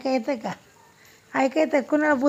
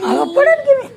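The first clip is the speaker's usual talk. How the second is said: much higher, louder alarmed cry; startled cry; 13 semitones higher, 7 dB louder than usual. Real, not acted. Mother about to fall, crying out high and loud in alarm. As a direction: yelp as you lose your balance. Speaker nearly falls.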